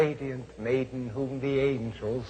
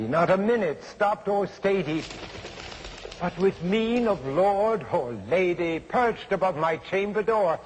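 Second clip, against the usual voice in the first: usual speaking voice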